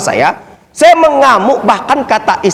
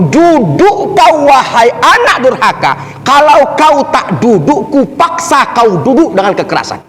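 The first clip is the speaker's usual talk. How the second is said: cursing back in rage; 3 semitones higher than usual. Retold or imitated. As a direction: order him down, stretching "duduk" into a threat. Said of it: "saya berdiri balik memaki dia". (menirukan amukan, membentak dengan nada tinggi)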